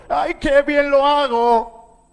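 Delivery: high, drawn-out boastful voice; mock show-off. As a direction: parody someone admiring their own work. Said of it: "para que nosotros nos exhibamos".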